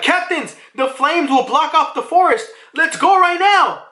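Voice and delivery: high, urgent call to the captains; high-pitched mock urgency; urgent giant crew. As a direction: imitate a crew calling out a plan. Acting out giants urging captains onward.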